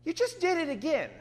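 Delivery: sudden high "Look," then a fast weary rebuke; a teacher at the end of his patience; Paul scolding the believer, exasperated. Acted. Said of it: "And Paul sighs again".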